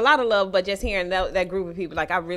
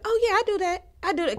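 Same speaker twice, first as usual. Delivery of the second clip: high, put-upon voice; high exasperated retelling of herself; lightly acted own reply; mimicking herself; 10 semitones higher, faster than usual.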